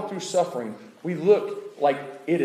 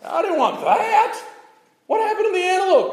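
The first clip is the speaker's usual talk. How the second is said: horrified protest; 11 semitones higher than usual. Retold or imitated. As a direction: cry out to stop the scene, appalled. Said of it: "and you're like".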